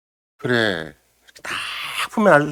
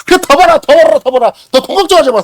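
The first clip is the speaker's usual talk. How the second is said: loud, high mock cheering as the world; a hint of a flattering crowd, loud and high; 10 semitones higher, 8 dB louder than usual. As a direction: egg yourself on like a flattering world. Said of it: "세상은 막 날 인정해줘서".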